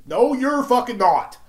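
loud, high, drawn-out contradiction; angry retort. Real, not acted. Snapping back at celebrities' claims, loud angry retort. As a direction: shout down the false claim.

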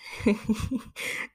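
short soft unvoiced laugh; breathy laugh. Real, not acted. After a pointed joke, soft laugh. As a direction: laugh off your own joke.

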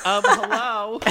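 very high, loud mimicry; Angelina. Echoing the co-host's impression of a tagline, high and loud. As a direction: squeal the catchphrase with exaggerated force. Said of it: "my favorite is Angelina's".

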